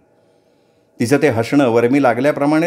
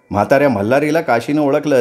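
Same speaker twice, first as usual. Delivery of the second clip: ordinary delivery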